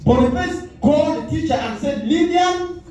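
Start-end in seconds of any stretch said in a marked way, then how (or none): none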